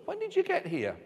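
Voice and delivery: high, astonished question; puzzled followers asking Jesus, in high amazement; surprised query. Retold or imitated. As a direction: ask it as if you can't believe they beat you there. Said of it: "they queried it".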